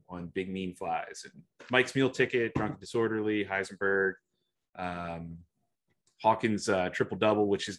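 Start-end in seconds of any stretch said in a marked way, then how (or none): none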